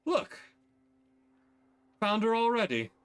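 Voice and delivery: cheerful relief as you clap his shoulder; pleased brother. Acted. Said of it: "pats his shoulder, like".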